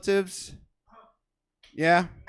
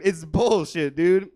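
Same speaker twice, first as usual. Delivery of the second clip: usual speaking voice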